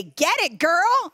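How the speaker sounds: high, loud sassy cheer; imitating a peppy cheer; mock cheer. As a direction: cheer like a hype coach. Instructor's cheer quoted.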